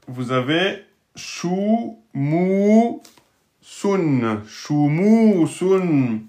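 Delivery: shumusun drawn out syllable by syllable; teacher spelling out shumusun; slow model reading. Shown as a demonstration. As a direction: read shumusun slowly and clearly for pupils. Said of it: "slow model reading of word".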